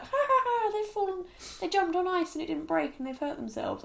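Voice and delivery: high mocking cackle; jeering at the fall; mocking laugh. Mocking people who laugh at falls.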